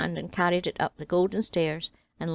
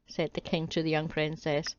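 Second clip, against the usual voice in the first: plain reading voice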